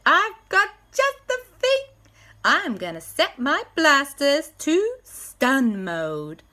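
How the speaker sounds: high, confident Nashville voice; a hero with a plan; lightly voiced Nashville hero. J B the hero plans.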